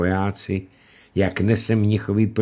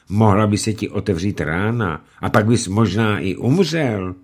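plain reading voice

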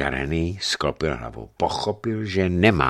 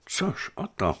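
sighing; 11 semitones higher, 3 dB quieter, slower than usual. A narrator's reading. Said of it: "povzdechl si Žarený".

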